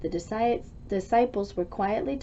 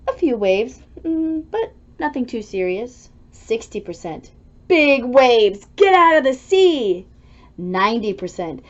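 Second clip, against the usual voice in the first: ordinary delivery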